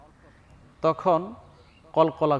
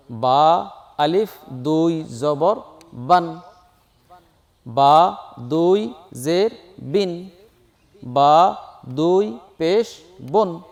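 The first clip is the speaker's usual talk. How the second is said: slow spelling recitation; slower than usual. Shown as a demonstration. Teacher showing students how to spell, slow chant. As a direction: chant each letter and mark slowly. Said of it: "তাহলে আমরা এইভাবে বলবো".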